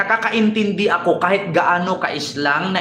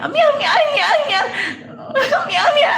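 much higher squealing mock Japanese; faster than usual. Showing how Japanese sound, high squealing mock Japanese. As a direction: squeak it out, laughing, as a parody. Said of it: "Ganun pag Japanese".

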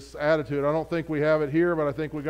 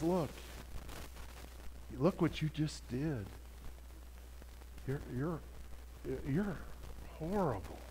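Satan; 4 semitones lower, 18 dB quieter than usual. Acted. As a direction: condemn softly, pausing on each repeated word. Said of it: "he says".

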